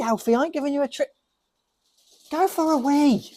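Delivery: high, pleading voice to a stubborn dog; exasperated dog coaxing. Real, not acted. Owner pleading with his stubborn dog to pee. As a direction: beg the dog to get on with it.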